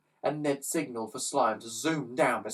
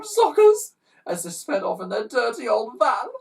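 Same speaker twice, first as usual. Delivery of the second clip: snuffling; 10 semitones higher, 8 dB louder than usual. Acted. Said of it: "snuffled a third".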